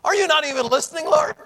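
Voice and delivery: high, loud, indignant protest; protesting Ananias. Pretend. Ananias objecting to the Lord, loud and high. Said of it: "Ananias could have said".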